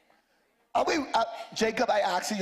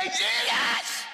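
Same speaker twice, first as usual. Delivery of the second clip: very high sustained shout; desperate high-pitched call, acted in full voice; Bartimaeus crying out; 12 semitones higher than usual. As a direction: scream the name out for attention. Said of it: "He got louder".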